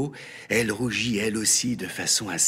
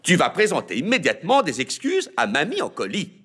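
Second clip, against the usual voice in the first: stern father; 9 semitones higher, 4 dB louder than usual. A narrator's reading. Father orders apology.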